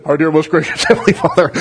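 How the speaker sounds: a hint of a solemn baritone, laughing through it; lightly hammed-up preacher intoning; mock prayer voice. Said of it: "his prayer voice".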